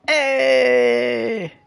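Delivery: high, loud sing-song hey; belting out a silly hey; mock Office hey. Gamer recalls The Office, mock sing-song hey.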